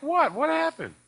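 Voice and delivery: high startled what happened; alarmed surprise. Retold or imitated. Patient hearing bad news from doctor, high and alarmed. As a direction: jump up in pitch, alarmed. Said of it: "I'm like".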